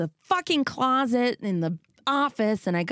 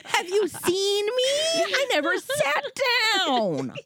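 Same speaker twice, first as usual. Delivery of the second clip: much higher, half-crying mock complaint; mock exhausted rant; 13 semitones higher than usual. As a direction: whine like a worn-out parent at the end of her rope. Role-play exhausted parent.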